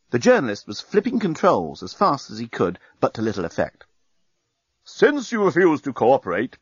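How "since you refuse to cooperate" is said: booming voice. Acted. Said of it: "boomed the voice".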